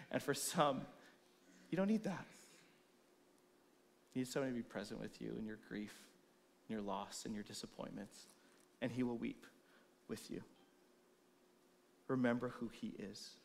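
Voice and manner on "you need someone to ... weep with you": soft, lower, gentle consolation; hushed caring pastoral voice; tender comfort. Real, not acted. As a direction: hold them in their grief.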